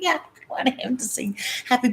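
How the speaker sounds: giggly secret whisper; whispered aside. Retold or imitated. Telling friends about the waiter, giggly whisper. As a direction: confide it under your breath.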